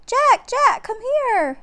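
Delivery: high, loud excited call; a girl yelling from the trees. A narrator's reading. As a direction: call him over, urgent and bright. Annie calls from the woods.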